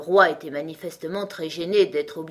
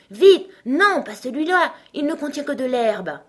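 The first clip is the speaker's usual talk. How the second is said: high, loud, hurried correction; flustered urging; 8 semitones higher, 8 dB louder than usual. A narrator's reading. King correcting Heiga's choice of sack, flustered. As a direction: burst out urgently to stop a mistake.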